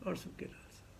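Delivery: breathed away to a whisper; whispered, fading repeat; whispered echo. Shown as a demonstration. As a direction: let the phrase vanish in a whisper.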